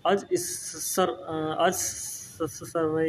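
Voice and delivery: slow, halting, quiet attempts at a hard word; struggling to pronounce. Real, not acted. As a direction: stumble over the word and trail off softly. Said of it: "pronunciation मैं नहीं कर पा रहा हूँ".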